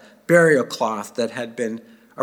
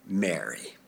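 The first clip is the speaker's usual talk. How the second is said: tender name; 9 semitones lower, 11 dB quieter than usual. Acted. Jesus says her name.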